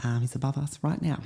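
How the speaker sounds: usual speaking voice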